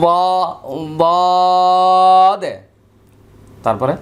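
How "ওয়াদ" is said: ওয়াদ drawn out, thick and loud; slow heavy letter demo; heavy letter, lips not rounded. Shown as a demonstration. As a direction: hold ওয়াদ long without rounding the lips. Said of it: "কোনো ধরনের গোল হবে না".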